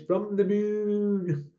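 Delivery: slow, hammed-up northern English accent; put-on estate accent. Shown as a demonstration. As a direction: exaggerate the local estate accent, stretching "moon". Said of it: "That's my accent from John from Welcome Estates".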